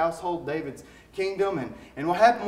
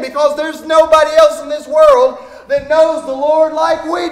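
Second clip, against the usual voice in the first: high, loud, impassioned preacher building to a peak; fiery preaching; 8 semitones higher, 11 dB louder than usual. Acted. Pastor urging the congregation to live differently, preaching loud and high.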